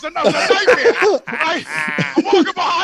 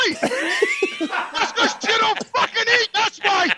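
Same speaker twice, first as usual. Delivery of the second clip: laughing scold; 6 semitones higher, slower than usual. Retold or imitated. Scolding Lee for not eating.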